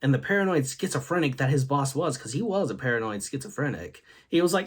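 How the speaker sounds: ordinary talk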